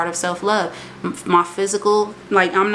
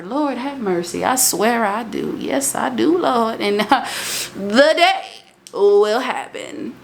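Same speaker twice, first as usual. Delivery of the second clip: lightly voiced old church lady, slow and sighing; a hint of a sassy old lady drawling Lord have mercy; mild old lady accent, playful; 4 semitones higher, slower than usual. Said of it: "don't let this old lady accent fool you".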